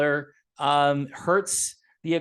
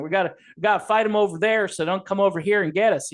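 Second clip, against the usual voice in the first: quick, louder, higher mock Texas drawl; imitating a Texas drawl, faster and punchier; 3 semitones higher, 4 dB louder, faster than usual. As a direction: ham up the Texan war talk a little. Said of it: "I can hear that little drawl Texas accent".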